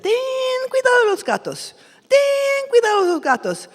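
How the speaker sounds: high, loud nagging warning; loud, high parental warning; parents warning. Acted. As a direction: sing out the warning to the kids. Parents warning every day.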